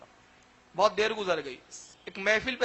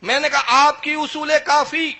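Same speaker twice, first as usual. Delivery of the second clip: fiery preaching; 4 semitones higher, 9 dB louder than usual. Acted. Preacher rousing the audience at the story's climax, full force. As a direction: proclaim each line to the crowd.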